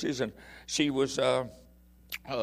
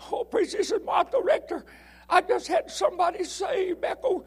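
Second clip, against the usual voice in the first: put-on high, tearful, laughing old woman; high old lady voice; 4 dB louder than usual. Preacher voicing Martha's excited phone news, high and tearful. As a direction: squeak it like an overjoyed elderly lady.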